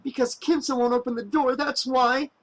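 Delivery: high, tearful protest; sobbed. A narrator's reading. Woman explaining to Waylander through tears, high tearful voice. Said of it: "she sobbed".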